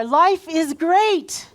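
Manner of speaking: rally the crowd with a big slow call; say it out there. Shown as a demonstration. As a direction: belt "Life is great" across the room. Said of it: "Really say it out there".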